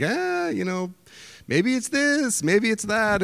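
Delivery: high, musing Tevye-style wondering; lightly sung-out pondering with God. Retold or imitated. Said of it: "like Tevye from Fiddler on the Roof".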